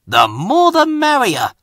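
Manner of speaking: cheerful little engine delighted to help; beaming little engine voice; happy. Acted. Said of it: "said Stepney happily".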